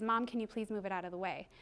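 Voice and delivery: soft, modelled polite ask; polite request. Shown as a demonstration. Mother modelling the right way for her daughter, polite request. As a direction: ask nicely, quietly and evenly. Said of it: "model for her the right way to say it".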